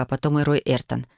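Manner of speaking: usual speaking voice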